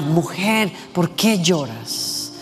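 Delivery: soft, slow, gentle question to a grieving woman; tender Jesus. Retold or imitated. Jesus gently asks weeping Mary why she cries, soft and slow. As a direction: lean in and ask her kindly, slowly.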